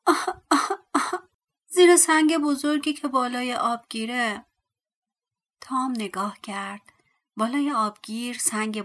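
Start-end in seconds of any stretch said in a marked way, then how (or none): 0.1-4.4 high, loud wailing plea; a trapped little man cries from under the stone; crying voice. Acted. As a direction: moan it out like someone trapped and weeping. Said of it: "صدا با گریه گفت".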